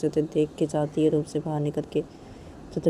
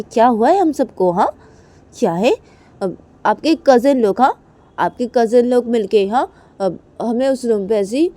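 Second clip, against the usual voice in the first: higher, louder furious accusing; furious cousin; 6 semitones higher, 8 dB louder than usual. Acted. Kajal's cousin accuses Reshma of locking them in, loud anger. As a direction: pour out the whole grievance angrily.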